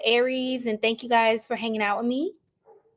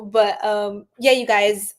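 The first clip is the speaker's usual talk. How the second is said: ordinary delivery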